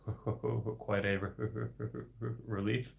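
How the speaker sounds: usual speaking voice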